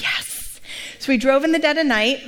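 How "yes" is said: breathy, whispered mock-excited yes; softly hissed retold "yes"; hushed eager yes. Friend eagerly agrees to Vegas.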